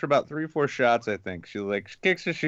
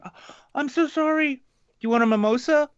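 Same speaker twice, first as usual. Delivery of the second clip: much higher, fast apologetic voice; embarrassed Lacey. Retold or imitated. Imitating an embarrassed Lacey offering him a mimosa. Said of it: "she's like".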